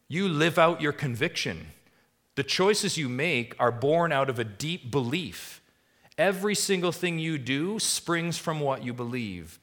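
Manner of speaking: loud, high, driving sermon climax; preaching at full force. Acted. Pastor urging the church, preaching at full force. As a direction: press the point home to the congregation.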